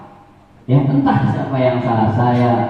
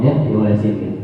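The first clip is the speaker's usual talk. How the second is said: usual speaking voice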